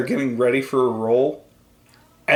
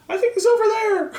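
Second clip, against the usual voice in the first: much higher fake voice from hiding; disguised voice; faster than usual. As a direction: throw a squeaky disguised voice.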